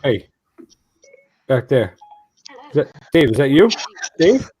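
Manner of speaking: hammed-up call; Jesus calling out. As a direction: shout to Dave at the back. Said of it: "He's like".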